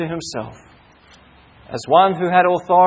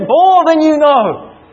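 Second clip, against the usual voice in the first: mock cry out; 7 semitones higher, 4 dB louder, slower than usual. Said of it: "the Lord Jesus cried out".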